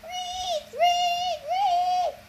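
high, piercing squeaks of a panicked guinea pig; guinea pig squeals. A narrator's reading. Percy turned guinea pig trying to scream for help, frantic squeals. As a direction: squeal it like a tiny trapped rodent. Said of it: "all that came out of my mouth was".

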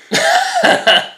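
sudden high-pitched laugh breaking out; high burst of laughter. Real, not acted. Laughs while reading out the fear of beggars. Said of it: "No te rías".

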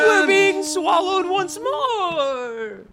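very high, slow crying wail; dramatic wail. Acted. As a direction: wail it as you tumble down. Being swallowed again.

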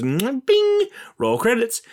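high mock announcer kiss-off; CinemaSins impression. Quoting CinemaSins with a high mock flourish. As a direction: toss it off like a smug reviewer. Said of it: "CinemaSins says".

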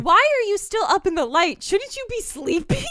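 high-pitched playful interrogation; hammed-up indignant questioning; mock scolding. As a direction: demand it in exaggerated disbelief. Acts out catching him up.

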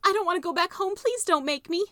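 put-on high, loud begging girl on the edge of tears; tearful pleading. Maybelle begs her brother, close to tears. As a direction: plead not to be sent home. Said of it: "Maybelle's eyes filled with tears".

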